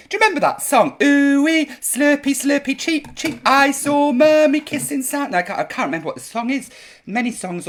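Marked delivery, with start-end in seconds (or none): none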